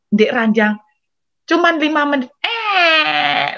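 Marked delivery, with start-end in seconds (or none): 2.4-3.6 long, high mock wail; sudden whining cry, imitated; imitated child's crying. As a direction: bawl like a baby left in the cot.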